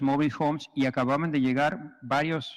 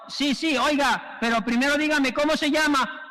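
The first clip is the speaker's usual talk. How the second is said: mock questioning; 9 semitones higher than usual. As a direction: calmly ask his name over the cries. El señor del puente pregunta. (voz aguda y pausada, preguntando el nombre)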